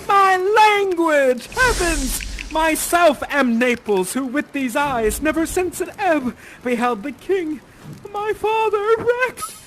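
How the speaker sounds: high, loud theatrical outcry; crying out. Acted. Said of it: "You cry out".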